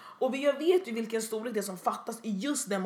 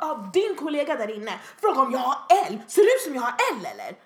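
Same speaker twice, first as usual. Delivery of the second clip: mock angry shouting; yelling customer; 5 dB louder than usual. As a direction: imitate an offended customer's rant. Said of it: "hon bara står och skriker".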